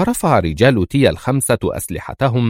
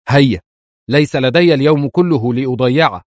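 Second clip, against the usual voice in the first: higher, louder impatient shout; 5 semitones higher, 4 dB louder than usual. A narrator's reading. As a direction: bark at him to hurry. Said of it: "فصاحت هي به". (صياح نافد الصبر بصوت أعلى)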